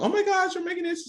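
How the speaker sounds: excited fan voice. Retold or imitated. Fans react to new platform.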